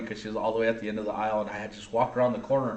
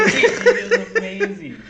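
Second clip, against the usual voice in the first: laughing; 8 dB louder, much slower than usual. Real, not acted. Laughing at wife's fart story.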